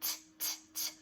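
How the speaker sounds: whispered T, T, T, quick unvoiced taps; breathy t t t for the first sound; isolated t sound. Shown as a demonstration. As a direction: tap out the T sound with no voice. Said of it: "What do you hear at the beginning of tomato".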